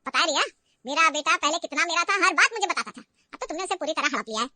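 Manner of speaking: loud bitter accusation; mother-in-law accusing Riya of stealing her son; taunting. Acted. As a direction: throw the jealousy at her hard. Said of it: "फिर से माँ ने ताना मारा".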